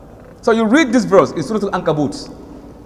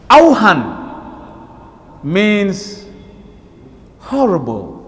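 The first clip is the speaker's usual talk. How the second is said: drawn-out Arabic word; much slower than usual. Shown as a demonstration.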